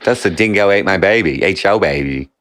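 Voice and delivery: lightly put-on Australian accent; mock Australian accent. Riffing on Australian lines, mock Australian accent. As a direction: imitate stock Australian catchphrases. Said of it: "That's Australian".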